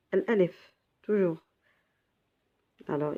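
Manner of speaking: ordinary delivery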